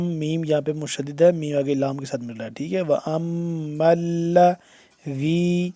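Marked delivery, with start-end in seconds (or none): 2.8-5.7 slow, sustained teaching recitation; measured Quran phrase for pupils; tajweed recitation. Shown as a demonstration. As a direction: chant it with long held sounds. Teacher recites the verse piecemeal.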